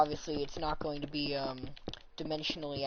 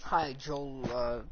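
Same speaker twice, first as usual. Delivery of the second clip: ordinary talk, nothing marked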